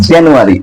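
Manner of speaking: জানুয়ারি said as "JAN-yu-a-ri"; British-style জানুয়ারি, first syllable "jyan"; British pronunciation. Shown as a demonstration. Teacher repeating it for the class, British pronunciation. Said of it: "ব্রিটিশরা এটা উচ্চারণ করে".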